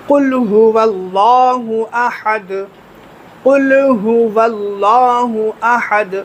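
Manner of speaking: slow, drawn-out tajweed chant of قل هو اللہ احد with every letter clear; correct Quran recitation. Shown as a demonstration. Teacher shows students the correct recitation, slow measured chant. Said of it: "یہ صحیح طریقہ ہے".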